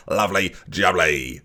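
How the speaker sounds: lightly voiced market trader, slow; a hint of a Cockney market trader; market stall owner. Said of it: "I turn into sort of a market stall owner".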